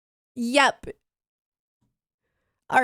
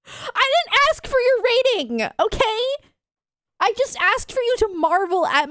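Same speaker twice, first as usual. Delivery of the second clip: mock indignant; 10 semitones higher, 3 dB louder than usual. Real, not acted. Mock offended at chat rating.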